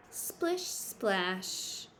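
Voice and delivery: playful splash sound word. Acted. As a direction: make it splash. Sound word in picture book.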